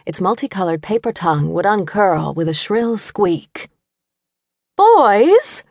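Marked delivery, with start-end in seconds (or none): 4.8-5.6 loud high call across the room; sharp call over the noise. A narrator's reading. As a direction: call the boys to order. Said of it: "Jane called out".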